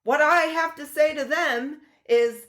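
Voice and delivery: put-on high, slow transatlantic accent; slow, lofty transatlantic accent of an old president; FDR transatlantic accent. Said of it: "I was trying to do my transatlantic accent".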